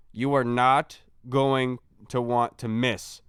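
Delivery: slow, word-by-word plug, dropping low at the end; slow emphatic hype. Real, not acted. Host selling Tuesday's show to listeners, slow and emphatic. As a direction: space out every word for weight.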